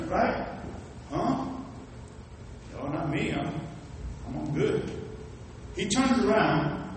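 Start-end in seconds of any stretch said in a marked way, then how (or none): 1.1-4.8 quiet. Retold or imitated. Other customer declines, softly.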